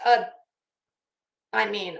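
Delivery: normal talking voice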